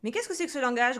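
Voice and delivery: loud, indignant reprimand; scolding father. A narrator's reading. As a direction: scold like a cross dad. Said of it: "gronda son papa".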